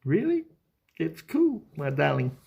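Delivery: mock sweet reply. Mock reply to wife's offer.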